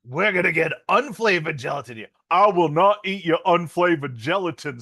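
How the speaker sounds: a hint of Keanu's bad Jacksonville accent. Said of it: "he's kinda turning into Forrest Gump".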